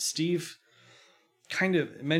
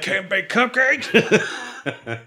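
loud, high mock cry of frustration; hammed-up yelled curse; frustrated outburst; 9 semitones higher, 9 dB louder, much slower than usual. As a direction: blurt it out like a thwarted baker.

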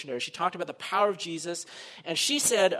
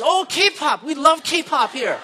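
higher and louder, gushing Oh, K-pop, slowing on the last part; thrilled professor; 9 semitones higher, 11 dB louder, much slower than usual. Retold or imitated. Professor delighted hearing a K-pop group will come, excited gush. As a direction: play the professor delighted at the news.

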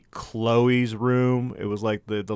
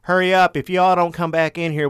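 mock shout, raised and higher; impatient guide call; 6 semitones higher, 5 dB louder than usual. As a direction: hurry the group along loudly. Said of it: "the tour guide's like".

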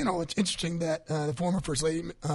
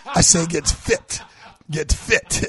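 "fit" slow and louder, a sharp final t; clear fit not fib; 5 dB louder, much slower than usual. Shown as a demonstration. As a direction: spell out "get fit" slowly, crisp t at the end. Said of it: "I didn't say fib".

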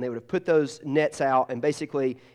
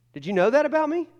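mock surprise; 11 semitones higher, 3 dB louder, faster than usual. Real, not acted. Playful question to congregation.

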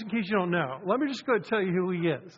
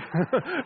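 real laugh; 7 semitones higher, 3 dB louder than usual. Real, not acted. Laughs at own joke.

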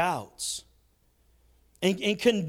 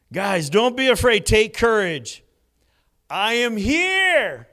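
reassuring call; 4 semitones higher, 5 dB louder, slower than usual. Retold or imitated. Jesus reassures the disciples.

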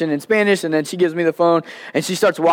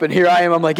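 ordinary delivery

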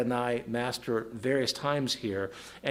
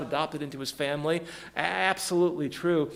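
usual speaking voice

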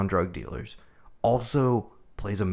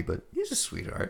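much higher, airy and soft; mock fond gush; 7 dB quieter than usual. Gushing about the lead actor to his cohost, high and airy. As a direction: swoon over him in a high airy voice. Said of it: "He's like".

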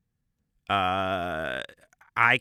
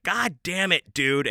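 much higher, louder exasperated cry; exasperated outburst; 8 semitones higher, 5 dB louder, slower than usual. Retold or imitated. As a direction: call him out as you burst in. Kicks open door on farting friend.

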